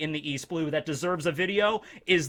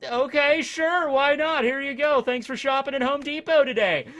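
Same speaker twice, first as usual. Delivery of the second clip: higher, louder customer-service voice; cheery cashier; 8 semitones higher, 5 dB louder than usual. Retold or imitated. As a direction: sound like a friendly clerk. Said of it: "I'd be like".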